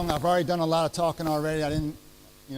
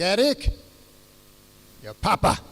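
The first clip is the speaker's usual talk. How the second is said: put-on louder, higher dad in a thick German accent; hammy German accent, calling the boy by name; 4 semitones higher, 7 dB louder than usual. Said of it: "in his great German, trying to be German language accent".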